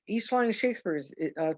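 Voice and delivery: ordinary delivery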